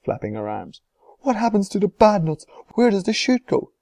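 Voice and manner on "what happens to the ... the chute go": high, loud panicked questions; frantic. A narrator's reading. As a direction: keep shrieking at Wonka. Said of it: "shrieked Missus Salt".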